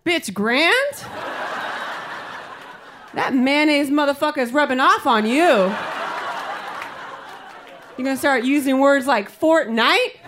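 high, swooping mock outrage; indignant friend. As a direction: scold in playful disbelief, pitch climbing at the end. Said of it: "So she says".

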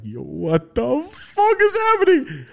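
very high, slow, stunned "yo"; excited and nervous. Retold or imitated. In his head at the strange scene, high slow excited disbelief. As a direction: marvel aloud in nervous thrill. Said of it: "I'm excited and nervous".

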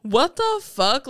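loud, high, baffled exclamation; incredulous outburst. Retold or imitated. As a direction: burst out in total disbelief. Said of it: "I literally remember going".

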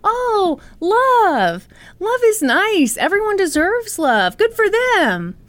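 mock sweet. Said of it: "And you may be thinking".

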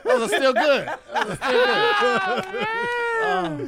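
drawn-out laughing shout. Acted. Joking defense of rib bones.

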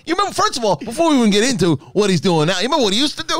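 high, pumped-up storytelling; excited. Pretend. Riffing to cohost about Fauci's past, high and excited. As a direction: keep it way up, buddy-story energy.

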